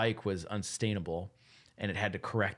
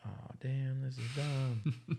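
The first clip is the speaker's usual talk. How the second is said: slow, higher-pitched deflated whine; mock dejection; 5 semitones higher, 3 dB quieter, much slower than usual. Quoting people whose cryptos became worthless, mock disappointed. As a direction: imitate a let-down investor, drawn out.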